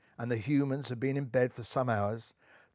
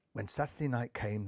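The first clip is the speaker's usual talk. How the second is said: usual speaking voice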